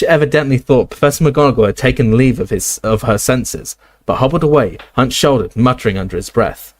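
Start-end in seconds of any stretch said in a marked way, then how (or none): none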